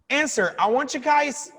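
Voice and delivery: normal talking voice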